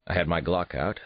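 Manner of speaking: plain reading voice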